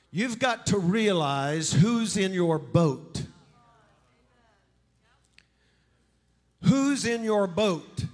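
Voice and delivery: slow, loud, pressed words; slow emphatic preaching. Acted. Preacher pressing the point to congregation, slow and loud. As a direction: lean on each word for the crowd. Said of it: "preacher repeats point slowly".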